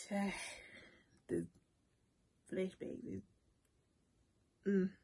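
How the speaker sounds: halting, choked up, with sighs and long pauses; fighting real tears between broken words; holding back tears. Real, not acted. Vlogger overcome by flashbacks of her illness, holding back tears. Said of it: "I ain't finna be crying on this camera".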